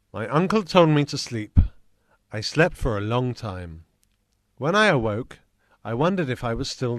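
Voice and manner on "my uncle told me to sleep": ordinary delivery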